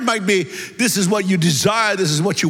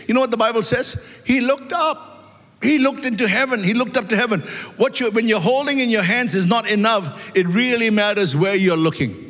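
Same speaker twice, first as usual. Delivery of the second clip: raised, ringing preacher's voice climbing higher; preaching at full force; 5 semitones higher than usual. Acted. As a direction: preach it out to the congregation, building fervour. Preacher builds to a climax.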